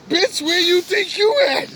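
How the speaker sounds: high-pitched mock challenge; incredulous retort. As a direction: throw it out high in acted disbelief. Acted incredulous retort to woman.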